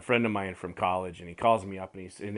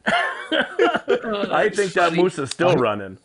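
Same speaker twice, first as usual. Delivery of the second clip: laughing; 7 semitones higher, 6 dB louder, slower than usual. Real, not acted. Wrapping up story, cracking up.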